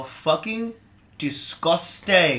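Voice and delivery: high, loud, drawn-out disgust; mock disgust. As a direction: play up the disgust. Mock outrage at nude story.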